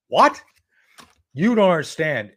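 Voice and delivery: high, loud stunned "What?"; shocked what. Retold or imitated. Retelling his shock at Barr's demand, high and loud. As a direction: blurt it out in disbelief.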